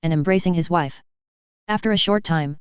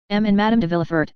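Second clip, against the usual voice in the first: ordinary delivery, nothing marked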